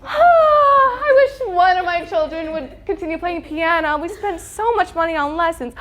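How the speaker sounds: hammed-up wailing sigh, then mock gasp; parent guilt. Parent lamenting money spent on piano lessons, hammed-up guilt trip. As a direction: lament it theatrically, as if overheard by accident. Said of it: "a degree in parent guilt".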